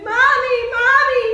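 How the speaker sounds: high, loud cries for mother; child screaming. Acted. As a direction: call out like a terrified child. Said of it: "[name] screaming".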